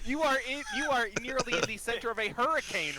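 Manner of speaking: high, loud laughing delivery. Real, not acted. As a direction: crack up while narrating. GM laughs at disadvantage roll.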